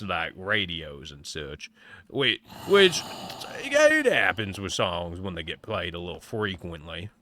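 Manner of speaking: lightly voiced southern cowboy; a hint of a southern cowboy accent. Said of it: "talking like this".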